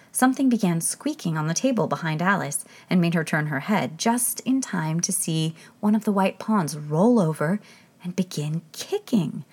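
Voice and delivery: ordinary delivery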